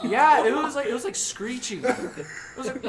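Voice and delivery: high loud laughter; laughing hard while telling; laughing loudly. Real, not acted. Describing screeching deer, laughing.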